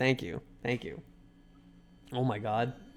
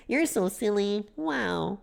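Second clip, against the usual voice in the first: put-on girly voice; 5 semitones higher, slower than usual. Voicing the winking girl.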